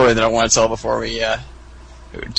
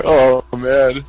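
usual speaking voice

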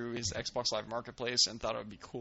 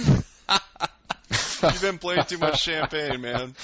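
laughing; 4 semitones higher, 10 dB louder, much slower than usual. Real, not acted. Laughing joke.

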